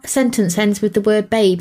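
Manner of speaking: ordinary talk, nothing marked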